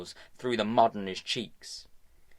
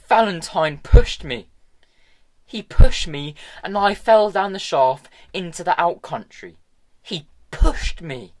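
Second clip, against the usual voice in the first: loud, high and pleading; boy insisting loud and hurt; upset insistence; 8 semitones higher, 11 dB louder than usual. A narrator's reading. Tom protesting to Hester, loud and upset.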